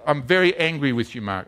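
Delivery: usual speaking voice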